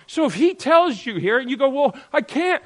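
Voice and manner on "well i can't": high, loud put-on whine; whiny imitation of a refusal; mock whining. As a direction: complain like a balking child. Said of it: "go ahead, whine, complain".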